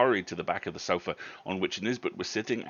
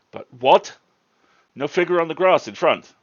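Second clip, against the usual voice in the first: loud, higher pressing questions; urgent question; 9 semitones higher, 13 dB louder than usual. Acted. Williams pressing Nisbet about the picture, loud urgent questions. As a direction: press him hard, startled. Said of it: "with marked interest".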